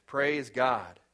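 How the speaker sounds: ordinary delivery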